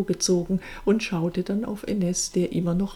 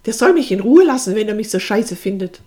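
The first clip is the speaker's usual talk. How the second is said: angry retort; 3 semitones higher, 10 dB louder than usual. A narrator's reading. Sister fuming to her brother about the man, angry. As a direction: fire it off crossly.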